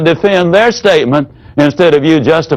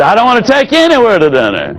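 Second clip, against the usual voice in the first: grumpy husband; 6 semitones higher, much faster than usual. Retold or imitated. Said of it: "He might say".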